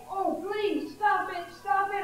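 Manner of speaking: mildly acted desperate plea; crying out. A narrator's reading. Clay gagging on paint fumes, crying out in protest. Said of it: "I cried".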